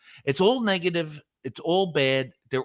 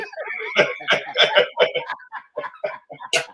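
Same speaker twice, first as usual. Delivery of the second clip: long breathless high-pitched laugh; real laugh, squeaking up high; high wheezing laugh; 3 dB louder than usual. Real, not acted. Cracking up at his own story.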